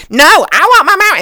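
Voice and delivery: shrill high screech of an angry customer; pterodactyl voice. Retold or imitated. Imitating the angry customer demanding a refund, pterodactyl voice. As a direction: squawk it like a pterodactyl. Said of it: "pterodactyl voice".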